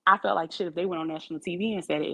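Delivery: usual speaking voice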